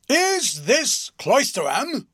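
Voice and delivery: booming, high and slow, a big man bellowing; tremendous voice. A narrator's reading. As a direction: roar the question from the box seat. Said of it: "in a tremendous voice".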